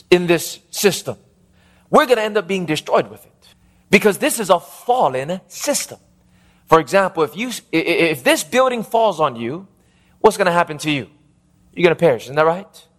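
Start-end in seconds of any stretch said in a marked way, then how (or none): none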